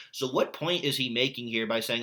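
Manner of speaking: ordinary talk, nothing marked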